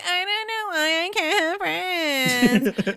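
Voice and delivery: high, stretched-out whimper; mock crying. Imitating Juliet still crying on the side, mock sobbing. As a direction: wail it like a hammed-up sobber. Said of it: "still crying".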